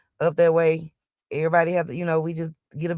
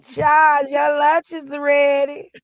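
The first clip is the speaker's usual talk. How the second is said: yelling across the room; 6 semitones higher, 5 dB louder, much slower than usual. Pretend. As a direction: call out loud and high, slowly. Calling someone to lunch.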